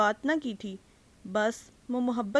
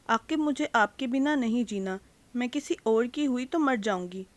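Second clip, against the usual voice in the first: ordinary delivery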